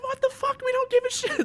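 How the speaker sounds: very high-pitched squealed mock war cry; mock battle cry. Gamer playing their squad charging in armored, high mock battle cry. Acted reckless charge into fight.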